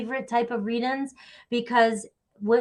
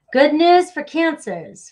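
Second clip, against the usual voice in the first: higher, louder, slow announcing; excited announcement; 6 semitones higher, 7 dB louder, slower than usual. Real, not acted. Reader telling Cancer viewers the good news, excited. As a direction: call it out with delight.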